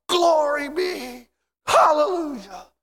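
high, loud, drawn-out praise; worshipful shout. Acted. Worshippers falling before the Lord, exultant shout. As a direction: belt each word slowly, pitched high. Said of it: "we're gonna shout".

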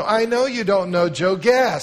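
put-on high, loud eager child voice; chirpy little girl. Girl asking Joe to look out the window, high and eager. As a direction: invite him brightly like a chatty kid.

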